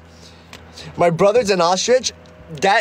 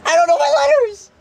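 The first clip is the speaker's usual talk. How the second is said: very high fast squeak; squeaky high voice; 3 dB louder, faster than usual. Pretend. Squeaky joke voice.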